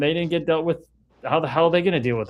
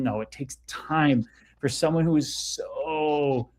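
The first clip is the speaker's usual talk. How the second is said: normal talking voice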